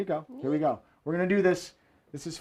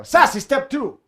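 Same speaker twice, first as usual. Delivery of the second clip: high sassy step announcement; 6 semitones higher, 3 dB louder than usual. Pretend. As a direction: call out the step with hammed-up sass. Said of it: "The sassy way, just for all of you".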